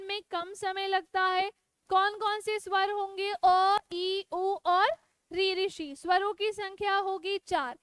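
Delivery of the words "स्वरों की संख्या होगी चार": usual speaking voice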